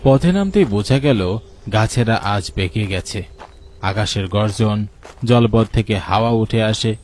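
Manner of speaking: normal talking voice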